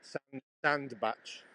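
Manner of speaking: "sand batch" drawn out, ending in tch; "batch" rhyming with match; slow pronunciation demo. Shown as a demonstration. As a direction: say "sand batch" slowly for a learner. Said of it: "We pronounce it batch".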